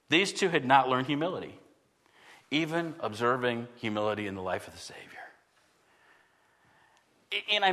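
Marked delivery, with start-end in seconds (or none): 2.5-5.0 whispered, soft and low; hushed aside. Real, not acted. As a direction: drop to a breathy hush for emphasis. Preacher drops to a hush.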